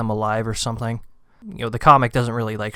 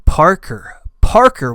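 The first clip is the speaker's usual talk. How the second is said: the name yelled louder and higher the second time; angry shout; 6 semitones higher, 10 dB louder, slower than usual. Retold or imitated. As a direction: roar Parker, rising into fury. Eddie raging at Parker in story.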